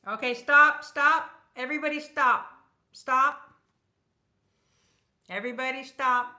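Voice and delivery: loud, high call to halt; loud shout. Real, not acted. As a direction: shout everyone to a stop. Halting live giveaway entries.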